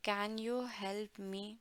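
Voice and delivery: slow quiet model line; slow soft repeat. Shown as a demonstration. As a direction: drag it out gently for learners. Slow softer English repeat.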